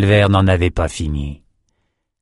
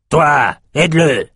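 slow, loud, higher command; barked royal order; 6 semitones higher, 3 dB louder, slower than usual. A narrator's reading. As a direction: bark it down at the slave. King kicks Eric, orders.

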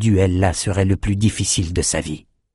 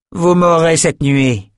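high, loud and slow, spitting the words; furious warrior woman's threat; flung threat; 8 semitones higher, 4 dB louder, slower than usual. A narrator's reading. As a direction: hurl it at them like a vow. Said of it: "lança-t-elle".

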